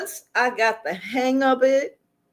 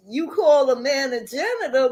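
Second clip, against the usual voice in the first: usual speaking voice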